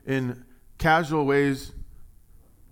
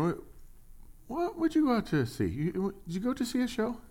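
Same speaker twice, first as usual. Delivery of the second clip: pitched way up, playfully mocking; teasing question; 11 semitones higher, 4 dB quieter than usual. Jesus teasing crowds who went out to see John, high mocking question. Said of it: "And Jesus is saying".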